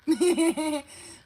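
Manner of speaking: loud, high burst of giggling; laugh. Real, not acted. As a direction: laugh out loud at your own joke. Laughs after her mock apology.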